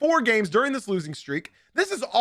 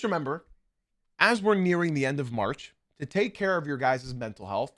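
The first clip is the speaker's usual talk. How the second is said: sincere encouragement; 5 semitones lower, 7 dB quieter than usual. Real, not acted. Sincere mental health message.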